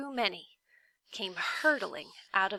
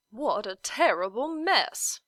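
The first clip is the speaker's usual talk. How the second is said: louder, pompous character voice; humbug voice; 4 dB louder than usual. Acted. Humbug tactlessly surveying the ruins, pompous voice. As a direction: boom it out like a tactless bug.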